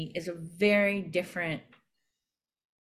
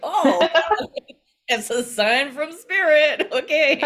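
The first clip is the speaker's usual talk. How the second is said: high, laughing delight; 9 semitones higher, 9 dB louder than usual. Retold or imitated. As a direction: giggle through a happy discovery. Continues the acted reaction, laughing.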